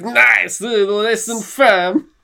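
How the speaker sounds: high, slow, put-on foreign accent; high drawn-out comic foreigner's voice; Borat. Host imagines the squeeze of the vice president, in a high Borat voice. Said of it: "He'd be like".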